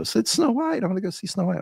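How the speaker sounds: imitating a child begging to see the movie; eager little kid. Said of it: "and they wanna go".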